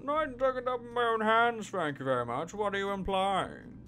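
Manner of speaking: high, loud, offended voice; indignant suspect. Acted. Suspect snapping at the detective, offended and high-pitched. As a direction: bristle at the accusation, slowing on the question.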